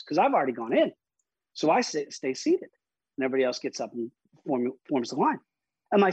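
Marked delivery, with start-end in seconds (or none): none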